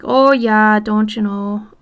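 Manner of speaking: leaned-in Minnesotan, loud on the opening words; heavy Minnesota accent. Shown as a demonstration. Streamer showing what leaning into it would be, heavy Minnesota accent. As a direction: lean hard into a caricature Minnesota accent. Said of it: "But I don't lean into it like".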